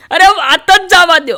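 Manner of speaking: high, loud fed-up complaint; exasperated groom. Retold or imitated. Newlywed groom complains to the comedian, high and exasperated. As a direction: wave it off, fed up.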